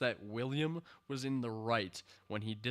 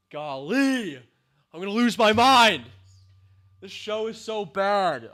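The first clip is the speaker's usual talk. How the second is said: high and loud exasperation; real exasperated yell; exasperated outburst; 7 semitones higher, 13 dB louder than usual. Real, not acted. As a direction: let the frustration burst out. Losing his mind over the show.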